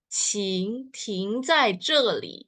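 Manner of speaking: "Qing ting zai zhe li." said slowly, word by word; slow Mandarin. Shown as a demonstration. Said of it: "cara bacanya". (pelafalan Mandarin pelan)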